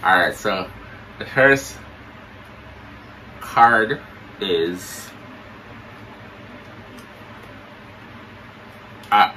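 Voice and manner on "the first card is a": talking with mouth held open; words through a propped-open mouth. Pretend. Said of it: "you say you're not pronounce good".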